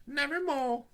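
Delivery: very high squawk; crow voice. A narrator's reading. Mortimer answering the phone, a high squawk. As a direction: croak it like a raven.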